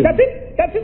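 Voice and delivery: high, quick excited repetition; seizing on the answer eagerly; eager agreement. Acted.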